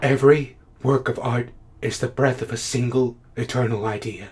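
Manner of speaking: low, soft, tentative; hushed recollection. Acted. Critic finding his lost thought, hushed and tentative. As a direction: feel for the line under your breath. Recovers lost line, quietly, then exclaims.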